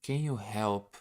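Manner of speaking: ordinary delivery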